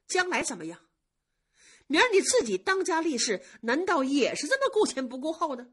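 a sigh, then a reproachful scolding; exasperated young master scolding. Acted. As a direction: chide the careless maid with a weary sigh. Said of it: "宝玉就叹着一口气说".

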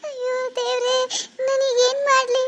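high, tearful wail to God; crying out. Acted. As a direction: sob it out in despair. Sister missing, she breaks down. (ಅಳುತ್ತಾ ದೇವರನ್ನು ಕರೆಯುವುದು)

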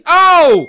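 loud, high cry of shock; startled yell. Real, not acted. Player jolted while looking ahead, startled yell. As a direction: shout out in sudden surprise. Sudden scare in game.

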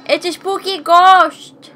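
high, slow, loud spooky tone. Pretend. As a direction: draw it out like a ghost story. Mocking spooky ghost line.

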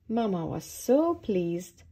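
ordinary delivery

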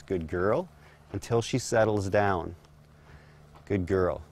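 low, soft settling words; calm her down; quiet soothing praise. Real, not acted. Settling the horse.

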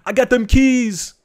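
[name] impression. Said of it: "Like D J Khaled".

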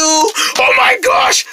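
high, panicked squeal; shriek, then stammer the alarm; terrified. Acted. Rabbit realizing the pig wants her, terrified.

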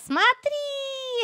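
normal talking voice

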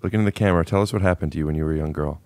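ordinary talk, nothing marked

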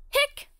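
short squeaky hiccup sound; a sharp little hiccup; high hiccup. Acted. Character has the hiccups.

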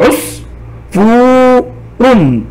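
"عص", "فو", "رن" slowly, each apart; syllable by syllable. Shown as a demonstration. Teacher sounding out a word for students, "عص - فو - رن" in slow beats. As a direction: stretch "فو" long and set it off from "عص" and "رن". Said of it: "ثم أحللها إلى مقاطع وحروف".

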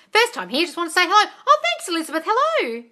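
bright greeting. Real, not acted. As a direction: sing out a welcome to a newcomer. Greeting a viewer cheerily.

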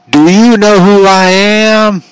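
loud, high, drawn-out mocking holler; obnoxious wrestler catchphrase. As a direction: stretch every word out obnoxiously, full volume. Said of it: "he says that so obnoxiously".

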